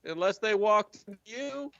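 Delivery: high, folksy drawling prisoner; chatty, smug old codger; Jorge voice. Acted. Jorge explains the prisoners' departure, high and folksy.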